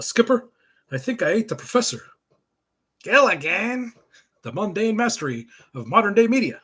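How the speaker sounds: hammed-up comic-book narration with character bits; cartoon narration. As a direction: sell each caption like a Saturday cartoon. Reads comic narration and voices.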